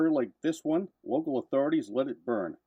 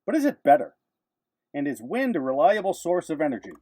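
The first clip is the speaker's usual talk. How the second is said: punchy newsreel narration, louder and higher; old newsreel narrator; 6 semitones higher, 5 dB louder than usual. Acted. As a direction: announce it like a vintage newsreel. Dramatic narrated news segment.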